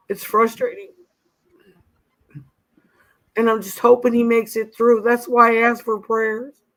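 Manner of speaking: talking through tears, fighting to hold it together; crying. Real, not acted. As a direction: voice lifted and strained with tears. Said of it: "Sorry, I'm crying".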